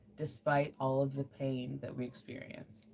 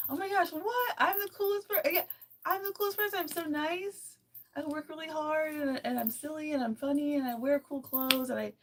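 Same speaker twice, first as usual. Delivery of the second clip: high, squeaky delighted self-hype; gushing self-praise; 11 semitones higher than usual. Acted. Showing how to praise yourself, gushing. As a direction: gush about yourself, starting very high.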